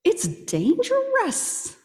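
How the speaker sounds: high, slow hushed warning; a high, drawn-out warning; urgent warning. Shown as a demonstration. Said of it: "Why do you think".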